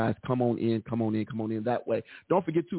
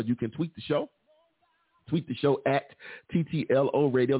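usual speaking voice

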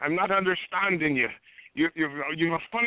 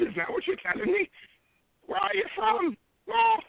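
much higher, in a put-on Irish accent; indignant mock Irish cry, pitched way up; shocked outburst; 13 semitones higher than usual. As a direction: squeal in mock Irish disbelief. Caller indignant at no gold.

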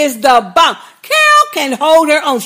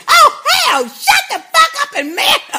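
very high, indignant squawk; exasperated outburst; 12 semitones higher than usual. Real, not acted. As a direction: scold him in a high pitch, nearly laughing. Ranting angrily at the show's character.

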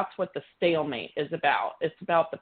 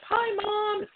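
sudden high chirpy greeting; bright high son's hello; voicing the son. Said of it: "he's like".

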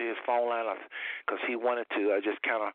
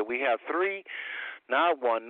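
ordinary talk, nothing marked

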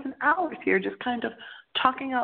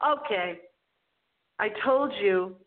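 usual speaking voice

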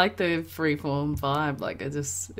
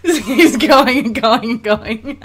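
laughing; 6 semitones higher, 11 dB louder, slower than usual. Real, not acted. Laughing through the words.

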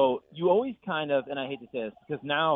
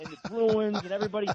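hammed-up Boston accent, pitched higher, with a laugh; bad Boston accent, laughing through it; 9 semitones higher than usual. Paul Revere reenactor asks about Boston teams, laughing Boston accent. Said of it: "that's a bad accent".

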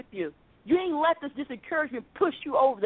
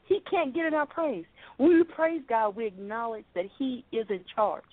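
ordinary talk, nothing marked

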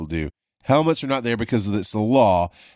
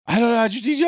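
lightly voiced panicked wife, much higher; frantic wife; 14 semitones higher, 3 dB louder, faster than usual. Wife on the phone begging husband to come, high panicked plea. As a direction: plead high and desperate. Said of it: "she's frantically c-, call-, she calls me".

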